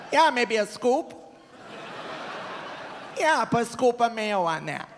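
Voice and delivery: high-pitched imitation of mom; lightly caricatured mother offering food; mom impression. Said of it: "I remember my mom".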